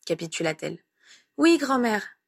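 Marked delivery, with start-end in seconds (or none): 1.4-2.2 put-on high, angelically obedient child; virtuous little boy. A narrator's reading. Little boy promises grandmother to behave, virtuously. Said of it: "promit-il de son air le plus vertueux".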